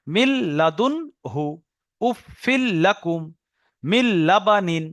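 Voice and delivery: মিল লাদুনহু, উফিল লাকুম, মিল লাবানিন slowly, doubled l held; pronunciation demo. Shown as a demonstration. Teacher showing the merged nun, slow model recitation. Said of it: "উচ্চারণটা কি কি হবে?".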